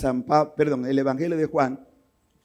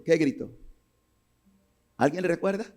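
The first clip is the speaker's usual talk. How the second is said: ordinary delivery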